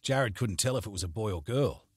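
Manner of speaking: plain reading voice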